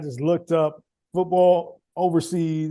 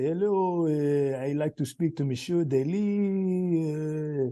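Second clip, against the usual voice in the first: slow, mock heavy French accent; 5 dB quieter, much slower than usual. French coach phoning a recruit, slow French accent. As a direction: imitate a French coach struggling with English. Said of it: "some guy with a really heavy French accent".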